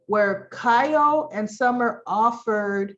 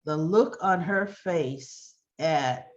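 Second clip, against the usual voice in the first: ordinary talk, nothing marked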